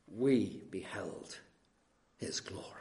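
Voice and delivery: slow, then fading to a whisper on glory; hushed awe. Acted. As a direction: breathe the words in wonder. Quoting John in awe.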